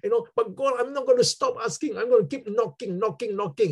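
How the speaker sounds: high, insistent pleading, re-enacted; retold tearful plea. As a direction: plead with God and keep pressing. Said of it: "I was crying".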